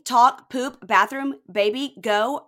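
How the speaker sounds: loud, high mock baby-talk, word by word; Tickle Me Elmo. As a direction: punch out each word like a talking toy. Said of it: "it sounds like Tickle Me Elmo".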